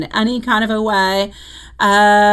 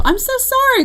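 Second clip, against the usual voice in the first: embarrassed apology. Real, not acted. Apologising for name mistake.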